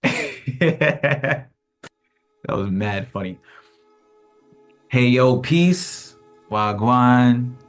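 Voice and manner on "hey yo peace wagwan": usual speaking voice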